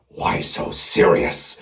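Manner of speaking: slow raspy whispered Joker; breathy slow threat; Heath Ledger. Retold or imitated. Joker taunting a victim, slow rasp. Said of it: "Heath Ledger".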